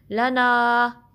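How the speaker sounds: very slow drawn-out chant; slow tajweed recitation; Quranic recitation. Shown as a demonstration. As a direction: recite it slowly, stretching the vowels. Recites word slowly.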